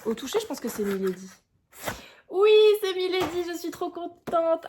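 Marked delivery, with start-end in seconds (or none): 2.3-4.6 high loud happy burst, then drawn out; delighted squeal. Real, not acted. Vlogger opening a parcel and guessing the publisher right, delighted. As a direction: squeal with joy at the reveal.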